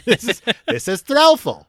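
high, loud Muppet-style voice. Pretend. Host introducing the name Threlfall as a Muppet. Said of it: "Jim Henson Muppet name".

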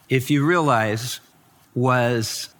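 usual speaking voice

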